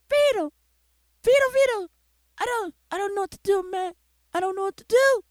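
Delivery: high, soft, helpless [name] voice; lightly voiced high whine of a lost friend; whiny [name] voice. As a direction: pipe up high and pleading as [name]. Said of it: "Speak normally, [name]. I know you don't speak like that".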